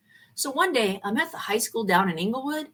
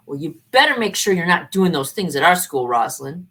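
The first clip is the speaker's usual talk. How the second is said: louder, low, stern official warning; 6 semitones lower, 7 dB louder than usual. Retold or imitated. College official warning the teacher, stern and loud. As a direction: lay down the law to her.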